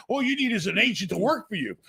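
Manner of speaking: usual speaking voice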